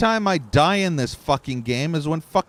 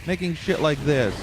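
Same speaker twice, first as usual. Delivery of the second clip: higher, heated ranting; angry rant; 9 semitones higher than usual. Real, not acted. Streamer cursing the studio over its game, heated rant. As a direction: vent it all at the developers.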